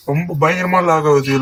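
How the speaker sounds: normal talking voice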